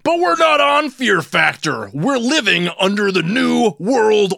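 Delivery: high, booming outraged rant. Acted. Show host shouts down his caller, ranting.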